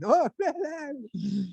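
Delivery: high, hammed-up childish wail; mock bawling. Boy crying to his dad, mock wail. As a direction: fake sobbing, exaggerated. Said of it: "Why are you crying?".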